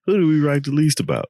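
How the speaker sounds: high, fast game-show question; mock quiz host.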